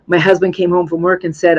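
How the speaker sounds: ordinary delivery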